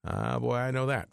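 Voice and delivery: normal talking voice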